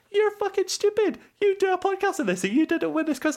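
very high, sneering mock voice; high, cartoonish sneer; mocking taunt. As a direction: squeak out the insult like a bully. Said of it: "You're basically just like".

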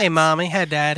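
a lazy Southern hello to the folks; Southern accent. Pretend. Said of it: "say it with a Southern accent".

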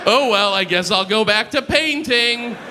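playfully acted "oh well"; mock resignation. Imagined Hitler gives up.

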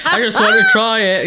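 lightly voiced Cockney quote; Cockney accent. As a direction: do a playful bit of Cockney. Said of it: "That was my Cockney".

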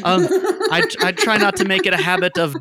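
apologetic character. Acted. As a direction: apologize with fussy courtesy.

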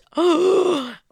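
high, audible horrified gasp; dramatic gasp. Acted. Storyteller imagining the armed man, dramatic gasp. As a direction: suck in air as if appalled.

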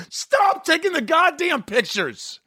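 high-pitched exasperated mock yell, drawn out; mock outburst. Host acting out guest snapping at the photographer, mock outburst. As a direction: blow up in pretend exasperation, high and slow. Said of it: "I'm waiting for you to go".

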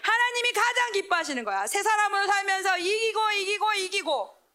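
high, ringing sermon climax; preaching at full force. Acted. Preacher urging the youth to win daily, full force. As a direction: proclaim it like a revival preacher.

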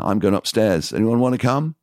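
upbeat crowd call. Retold or imitated. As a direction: shout it to the whole club. Calling to the club crowd.

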